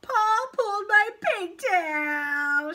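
high, loud, drawn-out wail; whiny high cry of complaint; wailing tattletale. A narrator's reading. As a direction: tattle at full volume, slowly. Leslie tattles after screaming.

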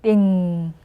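teacher's model "띵" with ringing end; demonstrating "띵". Shown as a demonstration. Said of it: "이렇게 해주시면 돼요".

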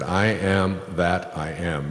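slow and solemn, each word given weight; slow, weighty declaration. Shown as a demonstration. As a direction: lay it down slowly like scripture. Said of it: "He said".

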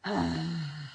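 long audible sigh out; exhaling sigh. Shown as a demonstration. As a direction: breathe out with a sigh. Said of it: "Exhale with a sigh".

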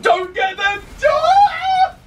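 high panicked shriek; stressed scream. Real, not acted. Stressed yelling at game.

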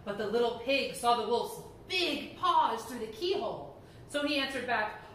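put-on lively read-aloud to children; animated storyteller voice; storybook reading. A narrator's reading. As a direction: tell it like a bedtime story to kids.

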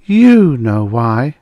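loud, drawn-out accusation; accusing. A narrator's reading. Woman with a sword confronts the man, loud and accusing. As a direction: pitch it up and stretch it into an accusation. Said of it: "she accused".

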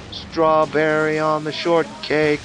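high, stretched-out exclamation; hammed-up alarm, vowels held long; drawn-out mock oath. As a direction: cry it out slowly in comic panic. Mock oath while fleeing in game.

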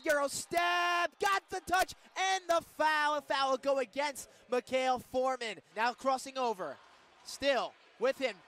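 ordinary talk, nothing marked

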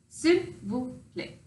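"S'il vous plaît" said slowly and carefully; drawn-out careful "S'il vous plaît"; French s'il vous plaît. Shown as a demonstration. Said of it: "looks like this".

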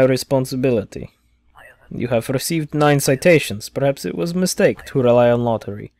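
put-on stern officer with Eastern European accent. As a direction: play a gruff Eastern Bloc official.

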